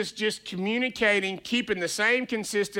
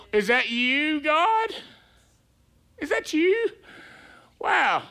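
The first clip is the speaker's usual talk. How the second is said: rising, much higher mock questioning; mock disbelief; 7 semitones higher, slower than usual. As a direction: act wide-eyed and doubtful, pitching up. Mocks Peter doubting Jesus.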